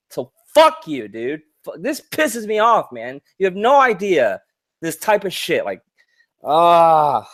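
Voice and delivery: real angry rant, pitch leaping up on the key phrases; getting mad. Real, not acted. Ranting at sellers who fake proof, genuinely mad. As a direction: let it build into an exasperated outburst and groan. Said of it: "sorry, I'm getting mad".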